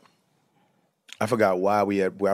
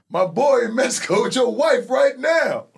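much higher, taunting heckler voice; 14 semitones higher, 4 dB louder than usual. Retold or imitated. Heckler at the comedy club taunts him high and mocking. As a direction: jab from the back row, pitched way up. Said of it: "he was like".

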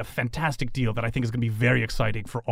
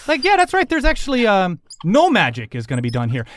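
put-on high, lively old lady voice; assertive elderly woman; 5 semitones higher, 5 dB louder than usual. Elderly woman forbidding magic, high and firm. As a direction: take charge with lifted pitch.

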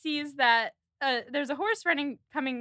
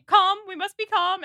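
raised, louder plea for calm; flustered mother; 6 semitones higher, 6 dB louder than usual. Retold or imitated. Mrs. Dashwood to her sewing daughters, flustered insistence. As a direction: imitate a mother urgently ordering composure. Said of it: "Missus Dashwood literally says".

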